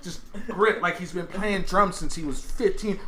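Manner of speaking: usual speaking voice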